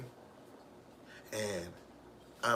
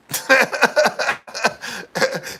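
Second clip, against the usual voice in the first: loud laugh; 13 semitones higher, 15 dB louder than usual. Real, not acted. Bursting laugh at being called a clout chaser.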